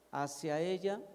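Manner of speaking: ordinary delivery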